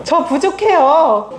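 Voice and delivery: slow, drawn-out admission of weakness; slow confession. Shown as a demonstration. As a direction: let each word sink in slowly. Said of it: "말해보세요".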